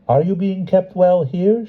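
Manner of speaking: a hint of royal curiosity; gracious empress. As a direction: empress greeting the Cossacks. The empress asking.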